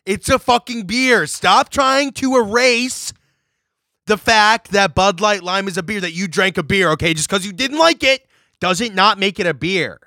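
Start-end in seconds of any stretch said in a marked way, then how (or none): none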